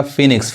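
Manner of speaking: फिनिक्स said as "fee-niks"; फिनिक्स in two syllables; correct pronunciation. Shown as a demonstration. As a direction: say फिनिक्स with silent o. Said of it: "फोएनिक्स नहीं पढ़ाएगा".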